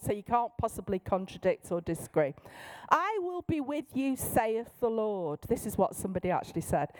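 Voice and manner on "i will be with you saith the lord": pitched up, then slowing to a solemn close; King James prophet. Acted. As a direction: intone it as a self-important prophecy. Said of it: "trying to prophesy with authority".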